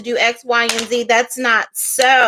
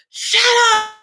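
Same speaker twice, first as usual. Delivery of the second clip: sudden shout; 12 semitones higher than usual. Retold or imitated. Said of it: "Loses it, right?".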